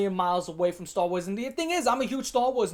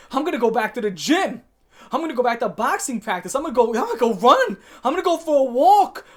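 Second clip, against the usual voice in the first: excitedly acting out eager people, louder and higher; mock excited; 4 semitones higher, 8 dB louder than usual. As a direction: build up the enthusiasm, imitating their relief. Acting out people finally going back outside.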